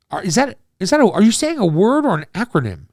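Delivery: baffled question. Retold or imitated. Confused high question on phone.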